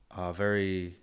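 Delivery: ordinary talk, nothing marked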